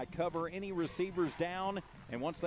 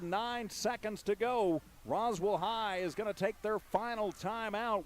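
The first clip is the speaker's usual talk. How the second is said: ordinary talk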